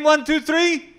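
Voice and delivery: high, punchy count-in to the crowd; rousing countdown. Real, not acted. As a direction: count them in with energy. Crowd told to shout names.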